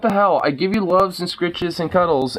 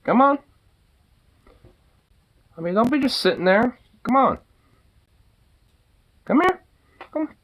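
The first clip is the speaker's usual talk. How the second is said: much higher, sing-song come-here; high coaxing call; 8 semitones higher than usual. Real, not acted. Owner calls the dog over, high and coaxing.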